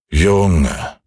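put-on old moon's drawn-out address; good old moon. A narrator's reading. Old moon to the restless boy, slow old character voice. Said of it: "sagte der gute alte Mond".

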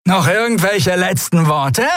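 mocking shout. A narrator's reading. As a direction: jeer at the prisoners. Draxyl mocks captives before shooting.

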